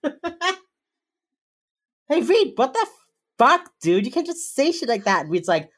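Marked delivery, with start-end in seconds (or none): 0.0-0.6 high-pitched incredulous swearing; mock outrage. Acted colleague scolding Reed. 2.1-5.2 high, loud, outraged telling-off; acted scolding. Acted colleague telling Reed off.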